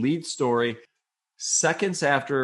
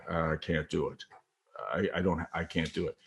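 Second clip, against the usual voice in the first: low, soft, sinking voice; defeated; 6 semitones lower, 6 dB quieter than usual. Retold or imitated. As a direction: imitate a man giving up, deflated. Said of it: "slowly sinks back down".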